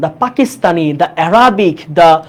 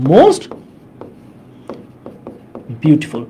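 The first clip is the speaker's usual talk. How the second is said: "most" raised and held open, long pause, then "beautiful" dropping low; dictation pace; 7 semitones lower, 4 dB quieter, much slower than usual. Shown as a demonstration. Teacher repeats the superlative for students to write, broken dictation.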